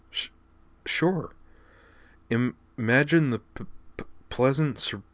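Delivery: stammering, repeated first sounds; nervous stutter, trying to be upbeat. Acted. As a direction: keep tripping over each word. Caitlin keeps stuttering.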